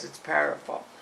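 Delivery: ordinary delivery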